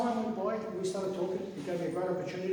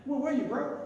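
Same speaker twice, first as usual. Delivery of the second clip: put-on casual Kiwi Maori accent, higher pitch; 7 semitones higher than usual. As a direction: ask it like a Maori mate would. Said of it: "in the Maori accent".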